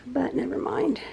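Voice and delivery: whispered aside, letting it go. Real, not acted. Mom resigned about the hutch, whispering. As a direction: breathe it out under the voice.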